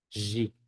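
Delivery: G said as a buzzing "zhi"; voiced zh pronunciation. Shown as a demonstration. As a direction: say G like French "j" plus ee. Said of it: "siempre se pronuncia".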